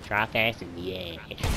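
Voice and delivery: low, slow, subdued repeat; corrected phrase. Pretend. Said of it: "I see that fucking hundred percent wrong".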